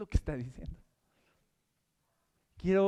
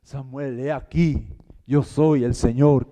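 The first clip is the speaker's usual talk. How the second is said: booming, much louder, slow godlike proclamation; movie God voice; 3 semitones lower, 11 dB louder, slower than usual. Pretend. Preacher parodying how films make God speak, booming. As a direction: thunder it like a Hollywood deity. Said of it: "En las películas".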